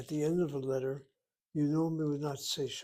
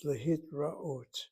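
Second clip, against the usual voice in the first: slow careful pronunciation; much slower than usual. Shown as a demonstration. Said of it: "and then we have a phrase".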